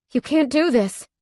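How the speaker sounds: high, urgent protest. A narrator's reading. Heroine protesting while Cade carries her, high. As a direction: protest hard. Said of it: "I protest".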